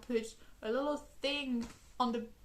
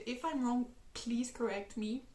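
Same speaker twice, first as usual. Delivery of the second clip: usual speaking voice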